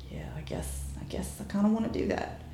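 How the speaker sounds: shy breathy whisper. Retold or imitated. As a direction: whisper it, embarrassed and hesitant. Shyly admitting she wants it.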